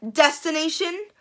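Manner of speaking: usual speaking voice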